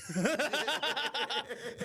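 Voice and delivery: high peal of laughter; big laugh. Real, not acted. Laughing at dad getting the age wrong, high cackling laugh. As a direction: burst out laughing at your own story.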